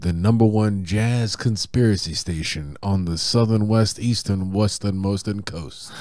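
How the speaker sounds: smooth late-night radio host intro. Acted. DJ welcoming listeners to the station. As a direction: announce it like a jazz station DJ.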